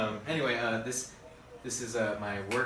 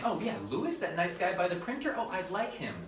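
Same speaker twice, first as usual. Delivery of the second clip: high-pitched imagined office gossip; imitated coworkers, chatty and high; admiring coworkers; 9 semitones higher than usual. Imagined admiring coworkers talking about him.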